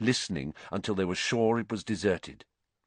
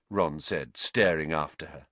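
usual speaking voice

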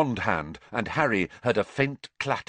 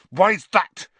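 spat out high and louder; 8 semitones higher, 8 dB louder than usual. A narrator's reading. Harry to his parents' betrayer, spat high and loud. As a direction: fling the question back in contempt. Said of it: "Harry spat".

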